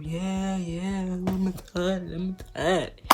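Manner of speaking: imitating a sleepy guy brushing someone off; half-asleep reply. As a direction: act barely awake, dragging the words.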